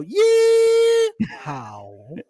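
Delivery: a long piercing crying Yeehaw; Dallas yeehaw. Shown as a demonstration. Mocking Dallas folks with a shrill yeehaw shriek. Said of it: "In Dallas, they go".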